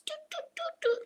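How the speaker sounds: soft, very high little toots; tiny quick peeps like wee birds; bird chirps. Shown as a demonstration. Showing Angela the cougar noise, high chirping. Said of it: "like that".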